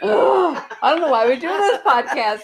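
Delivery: a high groan that melts into a laugh; mock exasperation. Co-host teasing her partner, mock annoyed and laughing. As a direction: play fed up with the podcast, laughing through it. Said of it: "It pisses me off every time".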